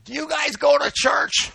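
loud, high-pitched call to someone far overhead; yelling up. Retold or imitated. Pastor to people in a hot air balloon, yelling up. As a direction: holler it up into the sky. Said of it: "I yell up at them".

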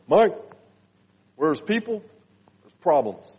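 a hint of wise old Bill giving advice; lightly imitating Bill Murph's measured voice; impression of Bill Murph. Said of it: "that was such a good impression".

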